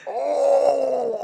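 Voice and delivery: long high-pitched "ohh"; impressed "oh". Retold or imitated. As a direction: hold a drawn-out high oh. Awed reaction to the creme brulee.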